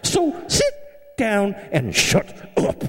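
high, prim English lady voice; high-pitched mock scold, sit down and shut up; prim director. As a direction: mimic the proper director scolding. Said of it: "she interrupted me, and she said".

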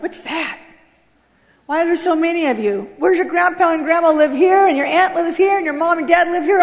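acted overwhelmed bafflement; bewildered. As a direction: pile up the questions in mock disbelief. Acted bewilderment at big family.

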